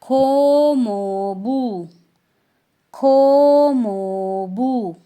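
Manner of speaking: "खोमोबू" very slow and loud; teacher reviewing Korean "खोमोबू"; Korean word. Shown as a demonstration. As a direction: model "खोमोबू" slowly twice. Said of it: "उसको क्या बोलेंगे".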